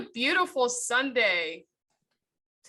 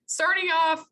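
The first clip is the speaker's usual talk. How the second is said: sarcastic; 8 semitones higher, 4 dB louder, slower than usual. Real, not acted. Sarcastic after bad errands.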